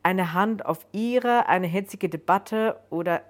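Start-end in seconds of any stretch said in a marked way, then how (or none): none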